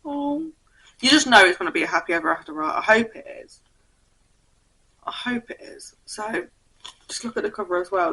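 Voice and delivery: ordinary delivery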